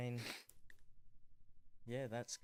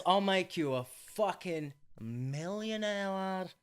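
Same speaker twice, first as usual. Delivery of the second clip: high, loud, stretched-out mock British accent; Simon Cowell; 7 semitones higher, 6 dB louder, slower than usual. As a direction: draw it out in a put-on British accent. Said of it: "I keep losing the accent".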